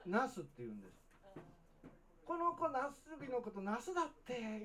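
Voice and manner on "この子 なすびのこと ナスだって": high, drawn-out teasing quote; mocking. Classmates laughing at the new kid's word, mocking. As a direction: imitate classmates making fun, slowly and high. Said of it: "言うて笑われるんですよ".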